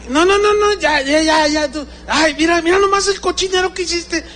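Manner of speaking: very high, fussing reprimand; high-pitched, hurried nagging; mock scolding. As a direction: imitate a grown child telling off mother. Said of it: "ahora me regañan".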